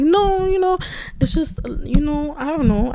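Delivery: high, loud protest easing down; flustered deflection. Retold or imitated. Waving off praise from people, flustered deflection.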